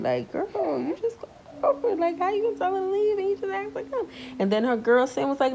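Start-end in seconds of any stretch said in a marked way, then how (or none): 0.3-4.1 high, fast incredulous whine; disbelieving rant in her head; whiny "how you gonna"; mock exasperated.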